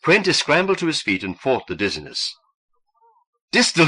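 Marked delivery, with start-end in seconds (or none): 3.5-3.9 high, loud cry of the name. A narrator's reading. Husband crying out as wife is attacked, high and loud. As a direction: shout for help. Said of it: "he cried".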